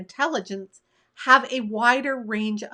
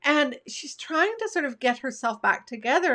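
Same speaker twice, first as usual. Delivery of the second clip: usual speaking voice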